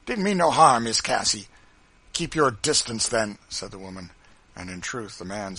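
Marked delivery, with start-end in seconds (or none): 0.0-1.5 loud, raised defensive protest; cowed bully's excuse; cowed and sullen. A narrator's reading. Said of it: "evidently cowed and sullenly retreating". 2.1-3.4 loud, commanding rebuke; firm, raised-voice defiance; stern warning. A narrator's reading. Woman warns off cowed driver.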